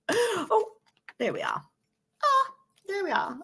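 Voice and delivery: real giggles, squeaky high bits; hysterical giggling. Real, not acted. Reader giggling helplessly at her own joke. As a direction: lose it to the giggles. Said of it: "I'm getting hysterical".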